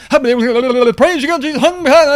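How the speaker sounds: high, hammed-up ecstatic praise; mock frenzied preacher. Preacher mocking rabid worship for his listeners, hammed-up and high. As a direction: overdo a rabid revival preacher. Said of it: "a power that preaches like a Baptist".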